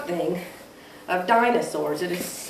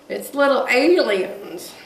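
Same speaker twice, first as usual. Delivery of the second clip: usual speaking voice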